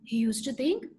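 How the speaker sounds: ordinary delivery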